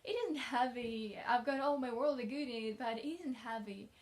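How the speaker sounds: put-on a touch of childlike cheer; cheerful child. A narrator's reading. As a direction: reassure eagerly, with a lilt. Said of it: "the child responded cheerfully".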